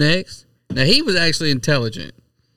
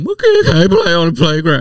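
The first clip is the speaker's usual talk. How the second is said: hammed-up mock complaint, put-on voice; exaggerated whiny send-up; mocking imitation; 4 dB louder than usual. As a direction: mimic an upset parent mockingly. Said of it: "wouldn't get up there talking about".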